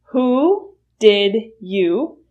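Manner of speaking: Who did you spelled out slowly; slow full form. Shown as a demonstration. Teacher showing the full form slowly. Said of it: "will reduce to".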